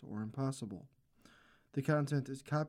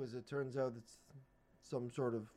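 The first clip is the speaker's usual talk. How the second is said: ordinary delivery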